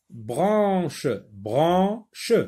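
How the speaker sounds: clapping-the-syllables voice; syllable count demo. Shown as a demonstration. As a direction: sound out "branche" for counting. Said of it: "deux syllabes".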